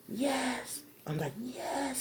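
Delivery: hushed, high little answer; very quiet. Retold or imitated. Girl at the window secretly answering mom, very quiet. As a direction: answer softly so nobody outside hears. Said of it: "And I'm real quiet".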